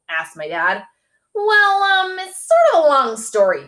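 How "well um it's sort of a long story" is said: flustered. A narrator's reading. Evasive to dad.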